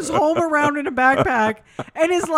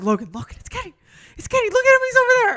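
high, eager pointing out; urgent excited nudge to a friend; 10 semitones higher, much faster than usual. Retold or imitated. Retelling spotting Kenny excitedly.

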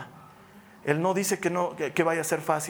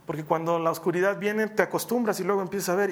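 ordinary talk

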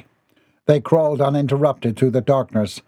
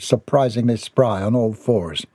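ordinary delivery

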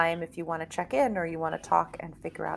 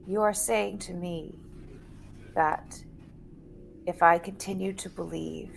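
hushed earnest gnome; 7 dB quieter than usual. Acted. Gnome Piven speaks slowly, hushed.

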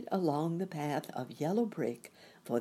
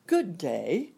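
husky; 3 semitones higher, 6 dB louder than usual. A narrator's reading. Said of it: "in a rather husky voice".

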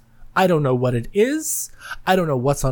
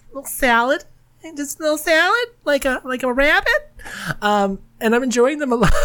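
much higher, cutesy little voice; silly squeaky voice; 9 semitones higher than usual. Pretend. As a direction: squeak it out like a tiny rabbit. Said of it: "What the fuck was that voice?".

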